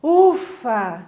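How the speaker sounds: heavy, weary "ufa"; a firmer, tired exhale; demonstrated tiredness. Shown as a demonstration. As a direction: puff it out as if worn out. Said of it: "De cansaço".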